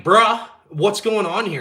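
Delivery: a suspicious buyer confronting a shady seller, slow and loud; suspicious bruh. Retold or imitated. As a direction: lean on it like you smell a scam.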